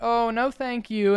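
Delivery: high-pitched mock-polite brush-off; polite refusal. New student turning down campus recruiters, high and polite.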